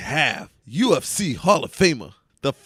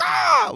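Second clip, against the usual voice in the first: bird call shout; 8 dB louder than usual. Shown as a demonstration. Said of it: "I guess we can do".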